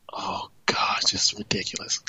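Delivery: exasperated whisper under the breath; whispered aside. Real, not acted. Host alone on air, whispering in disgust. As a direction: hiss it quietly to yourself. Fuming host mutters to himself.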